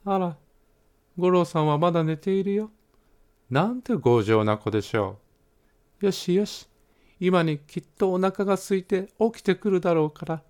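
a mildly exasperated mom muttering to herself; mother scolding her stubborn son to herself, lightly voiced; mother talking to herself. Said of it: "お母様は独り言を言って".

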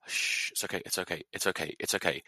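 very soft, imitated comforting murmur; hushed, repeated reassurance; soothing hush. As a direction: shush and calm someone gently. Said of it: "held me".

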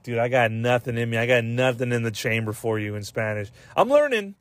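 usual speaking voice

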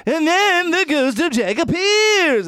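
high, loud, slow mock narration; hammed-up horror video voice; YouTube horror narrator. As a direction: stretch every word like an exaggerated YouTuber. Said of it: "he's literally going like".

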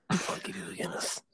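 hushed, menacing whisper; whispered threat. Retold or imitated. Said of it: "It's like in Taken".

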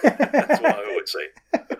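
says it through a big high laugh, slowed down; laughing at his own stock line. Real, not acted. To the interviewer, joking about missing out, laughing.

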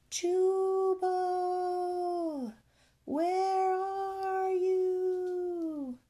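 high, long drawn-out holler; faint distant call. A narrator's reading. Searcher to bullfrog across the storm, distant calling. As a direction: stretch each word as if calling from afar. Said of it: "A faint voice called in the distance".

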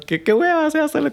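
high-pitched, mock-bored "qué hueva"; exaggerated disdain. Real, not acted. Mocking a tedious ego contest to a friend, high bored whine.

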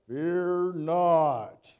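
a loud, booming "Fear not"; angel's command. Acted. Angel booming at frightened shepherds, loud command. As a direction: announce it like a towering angel. Angel acted, then questioned by speaker.

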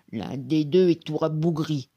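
put-on monster voice; hammed-up forest genie. A narrator's reading. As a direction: judge the prey in an ogre's voice. Genie sizes up his prey.

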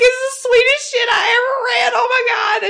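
loud, high-pitched blubbering wail; hammed-up sobbing, gushing at full volume; happy crying. Fan reliving the fic's ending, happy crying. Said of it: "I just started crying happy tears".

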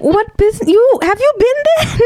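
very high incredulous squeal of questions; mock exasperation. Mock outburst at a friend.

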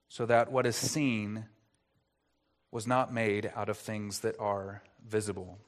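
usual speaking voice

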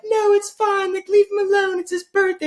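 very high falsetto imitation of women; stripper voice. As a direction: chime in as coaxing women, in falsetto. Strippers voiced, defending the friend.

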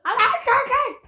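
high laughing shout. Real, not acted. Laughing excited callout.

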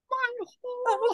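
high squeaky laugh through the words; laughing emotionally. Real, not acted. Nurse recalling grateful patient, laughing high.

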